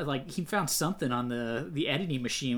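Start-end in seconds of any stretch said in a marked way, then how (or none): none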